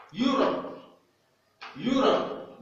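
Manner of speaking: Europe dit haut, E muet; English model word. Shown as a demonstration. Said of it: "E est muet". (Europe à l'anglaise, E muet)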